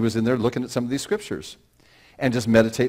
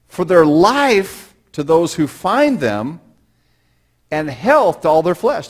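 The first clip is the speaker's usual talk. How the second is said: higher, louder, slow scripture reading; preaching at full force; 7 semitones higher, 9 dB louder, slower than usual. Acted. A pastor preaching the proverb to his church, loud and slow. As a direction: proclaim the verse to the congregation.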